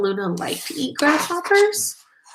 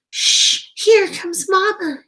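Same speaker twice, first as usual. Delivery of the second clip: hissed warning; 10 semitones higher, 6 dB louder than usual. Acted. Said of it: "hissed Pip".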